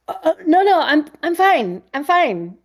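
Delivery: high, flustered reassurance; nervous high-pitched I'm fine; flustered denial. Retold or imitated. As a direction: brush it off in a high anxious rush. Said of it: "And I was like".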